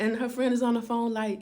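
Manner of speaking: ordinary delivery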